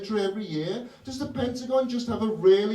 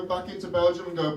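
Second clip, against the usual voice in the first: ordinary talk, nothing marked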